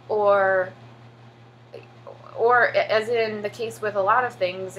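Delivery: normal talking voice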